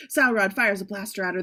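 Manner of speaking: ordinary talk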